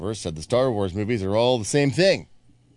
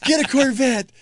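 very high, loud mock outburst; lame psycho; 6 dB louder than usual. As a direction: yelp it like a comically lame psycho. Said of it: "It's lame psycho. It's like".

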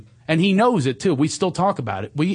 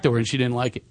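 ordinary talk

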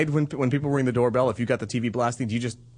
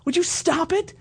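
much higher, exasperated wife voice; the wife pleading with him to stop; imitating wife; 11 semitones higher than usual. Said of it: "She's like".

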